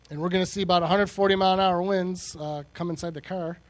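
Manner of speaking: normal talking voice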